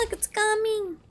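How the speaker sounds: usual speaking voice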